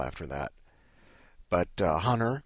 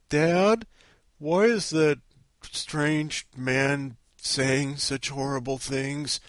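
high, slow mock little-kid voice; imitated kid; 5 semitones higher, 4 dB louder, much slower than usual. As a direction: play an innocent child questioning Dad, drawn out. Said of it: "he's gonna be like".

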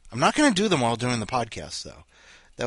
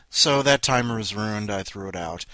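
normal talking voice

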